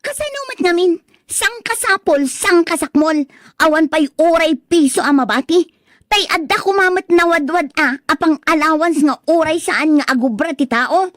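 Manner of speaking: high-pitched, fed-up griping; exasperated complaint. Acted. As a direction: vent every grievance at once. Wife complains about money again.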